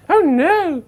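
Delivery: high squeaky cry of alarm; tiny high-pitched "Oh no!"; panicked grass. Acted. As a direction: yelp it like a cartoon victim. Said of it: "the grass was like".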